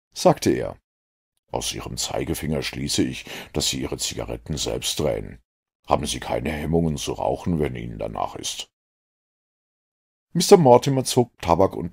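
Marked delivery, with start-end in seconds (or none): 1.5-8.7 a dry detective mildly regretting his deduction; lightly lower detective. A narrator's reading. Holmes to Mortimer, low and rueful.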